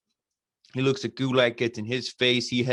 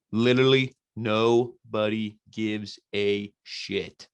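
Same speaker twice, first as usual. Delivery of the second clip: slow, word-by-word dismissal; emphatic disdain; much slower than usual. Real, not acted. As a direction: spell out your disdain one word at a time. Scornful verdict on the match.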